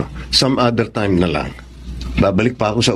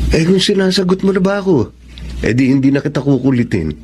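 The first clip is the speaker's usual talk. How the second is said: higher, playful and insistent; playful pleading; 6 semitones higher than usual. Acted. Suitor pressing the woman for her answer, playful and higher. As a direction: coax her into saying yes.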